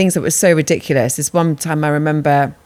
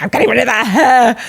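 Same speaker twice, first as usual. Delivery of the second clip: laughing; 8 semitones higher, 4 dB louder than usual. Retold or imitated. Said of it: "she was like".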